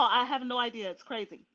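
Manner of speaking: usual speaking voice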